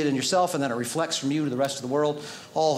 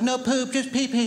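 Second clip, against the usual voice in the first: put-on much higher little-kid voice, insisting; toddler voice; 8 semitones higher than usual. Toddler son insisting to his dad, in a much higher little-kid voice. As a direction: play a stubborn toddler denying it.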